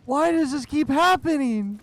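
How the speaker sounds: high, loud frustrated wail; exasperated cry. Real, not acted. Exasperated outburst after fright.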